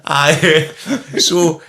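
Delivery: high, laughing out loud. Real, not acted. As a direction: laugh through it.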